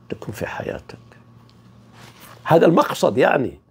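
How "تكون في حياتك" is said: hushed whisper to close the point. Acted. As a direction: let it fall to a whisper. (همس هادئ)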